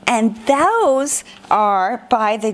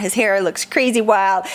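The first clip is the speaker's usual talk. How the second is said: ordinary delivery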